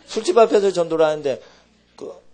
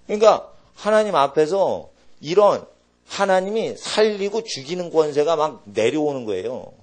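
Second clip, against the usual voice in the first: ordinary delivery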